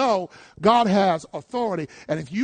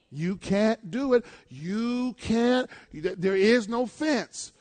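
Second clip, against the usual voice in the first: slow, each word laid down; slow insistent; 6 dB quieter, much slower than usual. Real, not acted. Preacher to congregation, slow firm insistence. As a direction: lay it down slowly and firmly.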